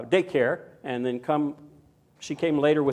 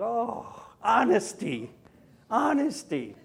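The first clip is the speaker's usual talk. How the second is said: slow wistful sigh; 6 semitones higher, slower than usual. Retold or imitated. Grandpa's wistful reaction.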